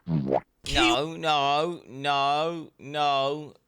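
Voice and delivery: loud, insistent no. Real, not acted. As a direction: shout down the claim. Correcting the video loudly.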